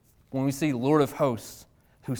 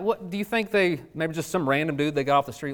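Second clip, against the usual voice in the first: ordinary talk, nothing marked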